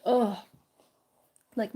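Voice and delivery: ordinary delivery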